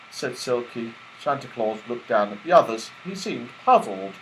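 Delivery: usual speaking voice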